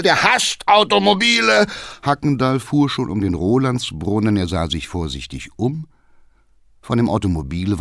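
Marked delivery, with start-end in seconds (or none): 0.0-1.7 high, loud call back. Acted. Cabman calling back to the councillor. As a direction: shout over the noise. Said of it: "rief Hackendahl".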